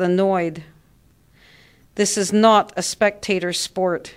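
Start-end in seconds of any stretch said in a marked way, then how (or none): none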